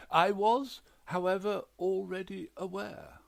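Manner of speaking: high, loud, unbothered gentleman; posh widower. A narrator's reading. Widower to the detective, high and loud.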